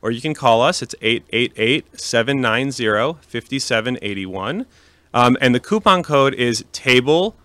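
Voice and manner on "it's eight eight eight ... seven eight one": slow and clear. Shown as a demonstration. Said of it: "reading out phone number".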